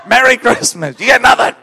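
laughing, hammed-up dismissal; not having it. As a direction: imitate a fed-up counter lady shouting the brush-off. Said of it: "She was not having it".

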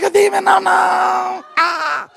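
high, loud squeal of pretend fright; comic scaredy-cat wail; mock panic. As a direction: recoil in hammed-up terror. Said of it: "people can't even handle the thought".

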